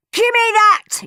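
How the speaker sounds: high, loud demand; yelling. A narrator's reading. Henry lunging for the wrapped toy, yelling. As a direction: yell it as you grab. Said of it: "yelled Henry".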